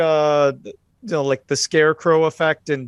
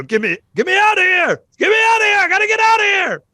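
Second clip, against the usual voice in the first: high, loud Southern panic, words drawled; southern drawl shout; 4 dB louder, much faster than usual. Acted. [name] redoes his panicked yell in a Southern drawl. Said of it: "southern drawl with that".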